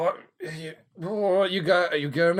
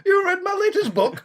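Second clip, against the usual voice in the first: high put-on voice. Plays Tolkien, high voice.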